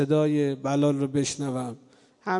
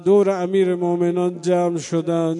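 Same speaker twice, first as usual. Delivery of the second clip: loud mourning sermon at full force, half sung; impassioned preaching; 6 dB louder than usual. Acted. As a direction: cry it out to the mourners in the dark.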